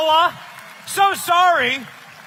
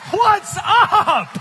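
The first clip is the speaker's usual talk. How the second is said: ordinary delivery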